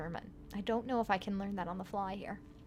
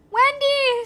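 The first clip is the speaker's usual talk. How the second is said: high, loud panicked scream; 13 dB louder than usual. Acted. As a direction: scream her name in terror. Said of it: "screams one of those".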